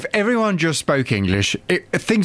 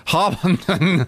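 slow, higher Harpenden in a German accent; 4 semitones higher, slower than usual. Shown as a demonstration. Co-host attempts her town's name in German, German accent. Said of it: "can't say Harpenden with a German accent".

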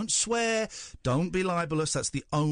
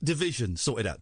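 usual speaking voice

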